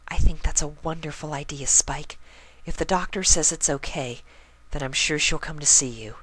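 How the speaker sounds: high, kind, timid pony voice; Fluttershy voice. Acted. Fluttershy encourages Spike to apologize, gentle high voice. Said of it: "Fluttershy said".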